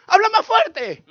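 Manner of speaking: high, loud demand; calling out. Acted. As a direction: shout it as if hard of hearing. Acts listener demanding louder speech.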